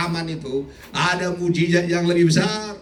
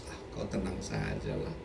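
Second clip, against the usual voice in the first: much lower, soft, offhand doctor; doctor's voice; 14 dB quieter than usual. Retold or imitated. Preacher imitating his doctor's calm reassurance. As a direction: drop the voice low and casual. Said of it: "Dia bilang".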